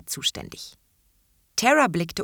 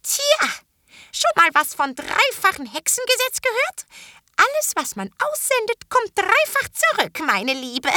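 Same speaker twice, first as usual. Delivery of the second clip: very high, loud, mocking old witch lecturing; gloating old witch; 14 semitones higher, 4 dB louder than usual. A narrator's reading. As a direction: sneer it sweetly like a spiteful old hag. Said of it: "sah sie erbost an".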